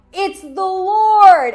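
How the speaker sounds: high, drawn-out, very loud cry; calling out. Acted. Peter recognizes Jesus from the boat, calling out loudly. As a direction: shout it long and high toward the shore. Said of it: "And he calls out".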